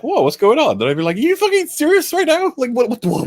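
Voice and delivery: high-pitched, stammering disbelief; hammed-up shocked reaction; acted incredulous alarm. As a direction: play someone baffled and demanding answers. Acting out a realistic panicked reaction.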